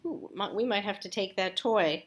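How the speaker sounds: ordinary delivery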